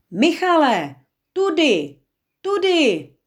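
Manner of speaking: high, loud call across the water; a boy yelling to guide his cousin. A narrator's reading. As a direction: shout for him to come this way. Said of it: "vykřikl, jak nejhlasitěji dokázal".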